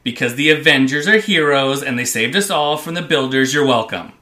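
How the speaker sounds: loud, hammed-up fanboy proclamation; gushing comic-book fan, playing it big. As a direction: boast proudly on your heroes' behalf. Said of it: "let [name] fanboy out a little bit".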